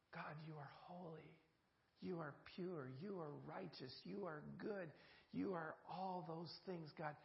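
soft, hushed address to God; quiet prayer to God. Acted. Prays directly to God.

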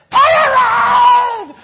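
huge drawn-out, high-pitched mock roar; hammed-up slow scream, groggly voice; imitated child's groggly yell. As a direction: yell it like a six-year-old's bad accent. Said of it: "she really just yells".

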